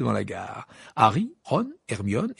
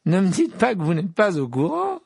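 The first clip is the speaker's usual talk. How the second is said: gleeful and higher, louder; Malfoy exclaiming, thrilled; delighted cry; 8 semitones higher, 5 dB louder than usual. A narrator's reading. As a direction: crow with delight at their ignorance. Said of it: "s'écria-t-il d'un ton ravi".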